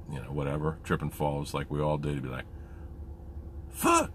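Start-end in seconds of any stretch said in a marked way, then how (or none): 3.7-4.1 high-pitched stubbed-toe exclamation, imitated; Presley. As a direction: squeak out a substitute curse in falsetto. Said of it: "he'd stub his toe".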